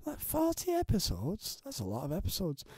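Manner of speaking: breathy exaggerated shock at the episode count; quiet mock amazement; mock disbelief. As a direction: act stunned by your own number. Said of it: "I go".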